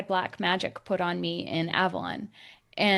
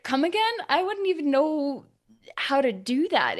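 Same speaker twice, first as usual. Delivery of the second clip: high incredulous; 6 semitones higher, 3 dB louder than usual. Retold or imitated. Reacting to absurd accusation.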